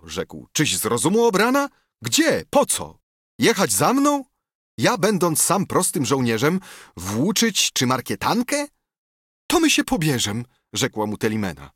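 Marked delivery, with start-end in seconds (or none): 0.5-8.7 higher, louder, fast scolding; indignant outburst. A narrator's reading. Tadeusz recoils at her proposal. 9.5-10.4 higher eager woman's voice. A narrator's reading. Telimena proposes marriage.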